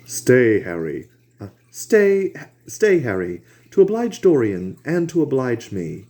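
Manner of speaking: Hallward pleading. A narrator's reading. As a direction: coax Harry to stay, insistent. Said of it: "said Hallward".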